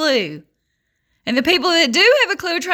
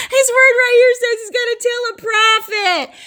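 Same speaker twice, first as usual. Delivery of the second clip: high, louder burst after a laugh; gleeful insistence; 10 semitones higher, 4 dB louder than usual. Real, not acted. Preacher citing His word against doubters, gleeful high insistence. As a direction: ride the laugh up into a high proclamation.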